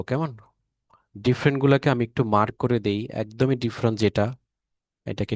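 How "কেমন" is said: ordinary delivery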